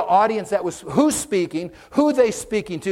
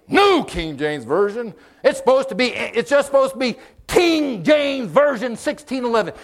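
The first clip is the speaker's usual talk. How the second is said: mock outrage, louder and rising; indignant KJV-only; 6 semitones higher, 4 dB louder than usual. Church member scolding about Bible versions, indignant. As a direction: huff like a King James purist. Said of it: "if somebody tries to".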